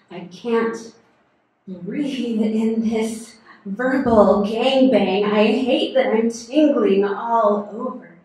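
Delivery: loud, distressed outburst; acted overwhelm. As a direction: cry out over the voices, performed. Overwhelmed by voices in poem.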